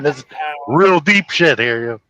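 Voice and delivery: ordinary talk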